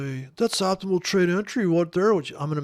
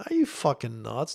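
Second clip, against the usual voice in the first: ordinary talk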